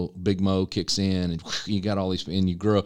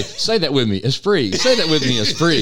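high, loud mock teacher drilling the word; playful imitation of a leader teaching a fancy word, ending in a laugh; mimicking a teacher; 6 semitones higher, 6 dB louder than usual. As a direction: ham up a leader getting the room to repeat esprit. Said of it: "that's what Sean and Gus say".

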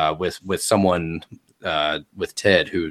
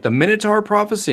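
high-pitched excited bystander; hammed-up crowd voice; another villager's guess; 4 dB louder, faster than usual. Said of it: "another one's like".

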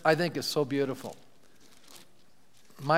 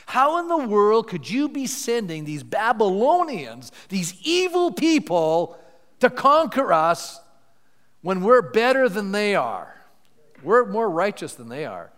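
protesting prophet; 5 semitones higher, 5 dB louder, slower than usual. Acted. Habakkuk protesting to God about the Babylonians, rising indignation. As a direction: voice an outraged prophet arguing with God.

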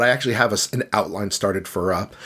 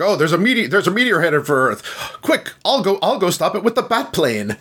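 high hammed-up heroic Batman voice; mock heroic Batman; 7 semitones higher than usual. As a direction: play it as an overeager caped hero. Mocking Batman rushing to save Earth.

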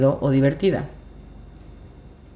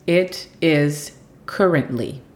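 slow model phrase; slower than usual. Shown as a demonstration. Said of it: "La siguiente frase es".

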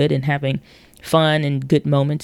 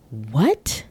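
baffled; 3 semitones lower than usual. Pretend. Reacting to absurd doctor.

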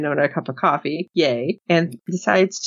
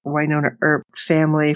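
ordinary talk, nothing marked

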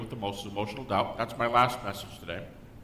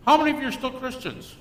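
high, louder taunting professor; mock professor, louder; 11 semitones higher, 5 dB louder than usual. Professor taunting the few remaining believers. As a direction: sneer the question at the class. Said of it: "at the end of the semester".

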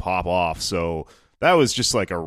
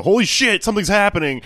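excited; 12 semitones higher, 6 dB louder than usual. Retold or imitated. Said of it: "something that makes you go".

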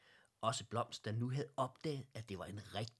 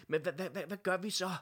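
panicked stammer; 9 semitones higher, 8 dB louder, faster than usual. A narrator's reading.

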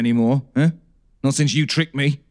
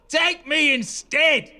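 laughing plea; 11 semitones higher, much slower than usual. Acted. Despairing plea after cable cut.

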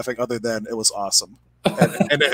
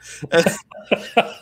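airy laugh; 6 semitones higher than usual. Real, not acted. Laughing at the story.

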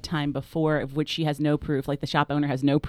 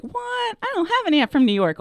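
high startled what, then indignant; shocked disbelief; 13 semitones higher, 3 dB louder than usual. Retold or imitated. Puzzled sister denies having an aunt, high and surprised. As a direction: squeal it in disbelief to the caller. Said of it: "And she was like".

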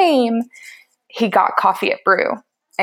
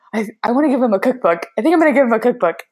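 fast, tumbling words; rushed nervous excitement; flustered rush; 4 semitones higher, much faster than usual. Retold or imitated. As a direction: blurt it out in a hurry.

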